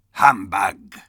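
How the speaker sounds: loud scornful bark; scornful Scrooge. Acted. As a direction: bark it with contempt. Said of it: "said Scrooge".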